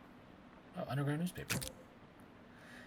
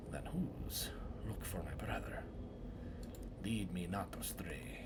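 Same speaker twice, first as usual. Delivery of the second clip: archaic woodcutter drama; 2 semitones lower than usual. Acted. Woodcutter interrogating suspects about the murder, hushed and theatrical. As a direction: play a solemn woodcutter grilling suspects in a murder.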